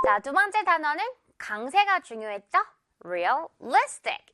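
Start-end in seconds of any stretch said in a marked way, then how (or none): 3.0-4.3 Realistic slowly, strong "li"; stress on the second syllable. Shown as a demonstration. Teacher reviewing the second word, Realistic stressed on "li". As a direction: punch "li" in Realistic. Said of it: "리 부분에 강하게 강세를".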